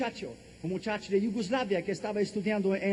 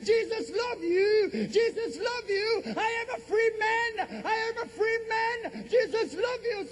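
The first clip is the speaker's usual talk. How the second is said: very high, drawn-out cries of triumph; reenacted exultant shouting; 13 semitones higher, slower than usual. Freed prisoner shouting in the airport, re-enacted exultant cry. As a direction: cry it out to the whole airport, stretching the words. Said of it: "empecé a gritar".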